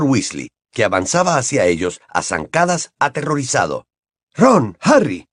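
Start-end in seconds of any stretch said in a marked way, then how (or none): 4.3-5.3 raised, anxious calling of names; trembling voice. A narrator's reading. Terrified father striding toward the children, trembling. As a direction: call for them, shaken. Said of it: "Su voz sonaba temblorosa".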